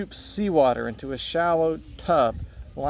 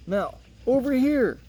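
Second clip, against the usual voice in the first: calling out; 11 semitones higher than usual. Acted. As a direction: call her over from across the water. Calling to Mel across boats.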